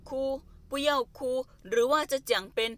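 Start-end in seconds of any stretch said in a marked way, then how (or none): 0.1-1.2 slow, clear model sentence. Shown as a demonstration. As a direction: say it slowly so students follow. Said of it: "slow model of phrase". (พูดช้าๆ ชัดๆ ให้นักเรียนตาม)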